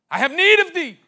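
high, loud ringing cry; the Lord crying out in his spirit. Acted. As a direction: cry out the Lord's call.

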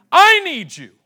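high, loud call; God calling out to him; loud divine call. Acted. God's voice calling, shouted.

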